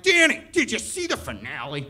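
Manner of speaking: high, excited mock friends. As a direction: gush about the finale, hammed-up. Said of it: "being like".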